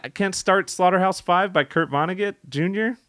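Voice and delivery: high, offended protest; mock indignant. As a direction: play it outraged for laughs.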